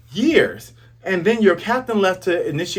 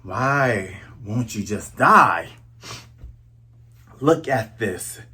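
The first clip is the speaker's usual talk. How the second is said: low, slow menacing villain voice; villain Kuro; 5 semitones lower, slower than usual. Retold or imitated. Kuro cursing Luffy for not dying, low slow menace. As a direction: growl it down low, slowly, like the villain. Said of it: "And so Kuro's like".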